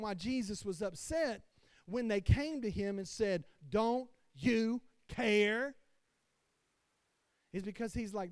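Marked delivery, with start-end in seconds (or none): 3.7-5.7 slow, loud pleading question; mock desperate disciples crying out. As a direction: drag it out like frightened men in a storm. Disciples quoted in storm.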